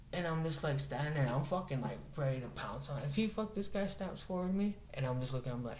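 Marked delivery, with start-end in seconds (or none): none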